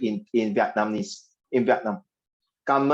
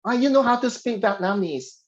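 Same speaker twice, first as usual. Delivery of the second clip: higher-pitched, lightly acted amazed question; mock pleasant surprise; 8 semitones higher than usual. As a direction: react as if delighted by a foreigner's greeting. Acting the Vietnamese person's pleased reply.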